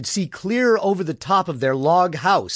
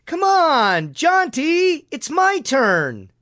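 very high, stretched-out pleading shout; 12 semitones higher, much slower than usual. A narrator's reading. Little Bud looking up at Jaunty on the swing, shouting. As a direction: shout up high, dragging out the words. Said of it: "Bud looked up at Jaunty and shouted".

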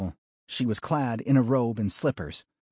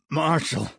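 strained, pained higher croak; grated out; 6 semitones higher than usual. A narrator's reading. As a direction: force it out through pain. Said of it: "Ames grated out".

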